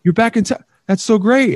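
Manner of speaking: lightly voiced keen acquaintance; eager guy's greeting. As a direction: play the guy overjoyed to run into her.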